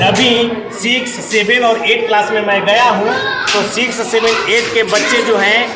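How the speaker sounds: news piece to camera. Acted. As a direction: hammer home each claim for the viewers. Reporter sums up for camera. (ज़ोर देकर कैमरे से reporting)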